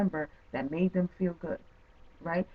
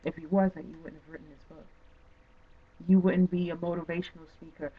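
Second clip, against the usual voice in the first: usual speaking voice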